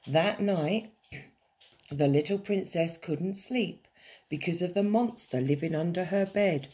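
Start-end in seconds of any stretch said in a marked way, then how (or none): none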